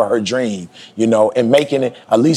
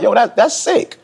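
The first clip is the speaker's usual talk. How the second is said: high, flat-out condemnation; retold disgust, pitched high; 9 semitones higher than usual. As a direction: say it like final judgement. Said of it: "I'm like".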